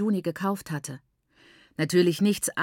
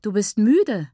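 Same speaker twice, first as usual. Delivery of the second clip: teasing; 3 semitones higher, 7 dB louder, slower than usual. A narrator's reading. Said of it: "neckte ich sie".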